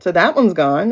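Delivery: normal talking voice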